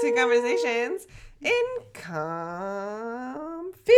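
slow announcer title read; show intro announcer. Acted. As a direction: announce the show name slowly.

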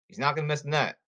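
ordinary talk

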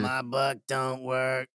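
slow, dragged-out repeat of "My butt don't work"; milk "My butt don't work" slowly; slowed gag line. Acted. Friend stretches the gag, saying "My butt don't work" slowly.

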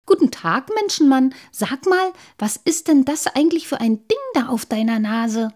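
high, loud, inquisitive greeting; cheeky youngster asking a question; curious little water sprite. A narrator's reading. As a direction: pipe up curiously to a stranger. Little sprite accosts stranger, curious.

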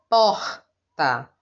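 first syllable stressed; stress on POR. Shown as a demonstration. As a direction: lean on por. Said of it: "O por é mais forte que o tá".

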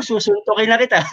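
mock threat through laughter; playful threat, laughing. Real, not acted.

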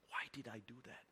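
unvoiced whisper to himself; whispered self-reproach. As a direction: hush it like a private regret. Thinking to himself in car.

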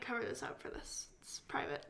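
hushed whisper behind a hand. Real, not acted. Covering her mouth, whispering so it stays private. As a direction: whisper so only the mic hears.

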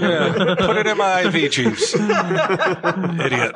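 high laughing spoiled rich snob; drunken posh sneer. Pretend. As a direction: sneer at the butler like a pampered brat. Pampered rich patient bit.

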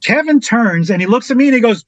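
ordinary talk

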